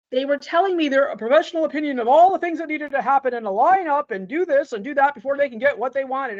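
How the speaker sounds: fast, loud, high-pitched pile-up of demands; exasperated imitation of people over-explaining; breathless rundown. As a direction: rattle off an overlong list without stopping. Mimics executives' long list of demands.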